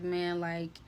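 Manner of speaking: low, subdued, defeated voice; retold distraught. Housemate is done with the cat, low and distraught. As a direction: give up quietly, voice sunk low.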